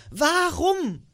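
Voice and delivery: high, loud, bewildered cry; called out. A narrator's reading. Nephew cries out at his uncle's refusal, high and pleading. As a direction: cry out in disbelief. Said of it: "rief Scrooges Neffe".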